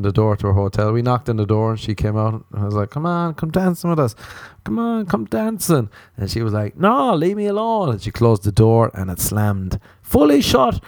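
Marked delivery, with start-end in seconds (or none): 2.9-5.9 mock pleading kid. Eager boy begging an older girl to come dancing, higher and pleading. 6.8-7.9 higher, dismissive brush-off; mock brush-off. Teen girl turning away the pestering boy, higher and dismissive. Girl refusing at her door.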